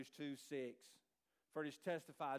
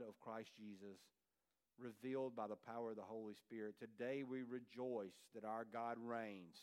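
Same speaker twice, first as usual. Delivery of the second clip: low, measured prayer voice; opening prayer; 4 semitones lower, 4 dB quieter than usual. Real, not acted. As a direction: pray it solemnly, pitched low and unhurried.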